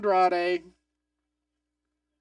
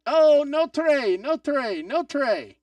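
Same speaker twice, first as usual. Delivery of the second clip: loud dismayed cry; 5 semitones higher, 8 dB louder, slower than usual. Real, not acted. Pleading as move fails live.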